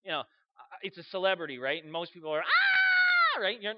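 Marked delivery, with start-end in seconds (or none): 2.4-3.4 high-pitched mock fangirl shriek; piercing, sustained squeal; excited fan scream. Imitating fans meeting a celebrity.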